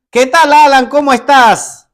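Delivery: loud, breezy greeting to a president; overly casual. Retold or imitated. Preacher mocking a too-casual letter, breezy and loud. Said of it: "Así no se, no se escribe".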